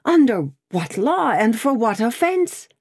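high-pitched, protesting question; indignant exclamation. A narrator's reading. Prisoner questions the officer's sentence, indignant exclamation. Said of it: "exclaimed Darnay".

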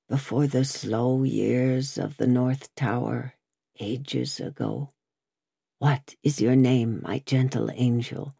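put-on low, gentle old prisoner; tender, subdued wondering; softened tone. A narrator's reading. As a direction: soften and sink into distant memory, then ask tenderly. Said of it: "Hailing his softened tone and manner".

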